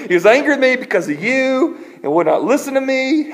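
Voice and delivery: high, loud mock indignation; hammed-up aggrieved outburst; acted blaming. Moses blames the people.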